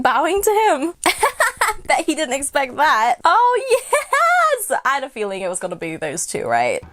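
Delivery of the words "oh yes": high-pitched elated yes; real delighted squeal; excited cheer. Real, not acted. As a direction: cheer with laughter in the voice. Cheering the dragon's reaction.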